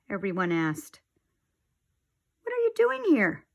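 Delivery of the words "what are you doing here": much higher, startled question; shocked to see him back; surprised. Acted. Family surprised at the door.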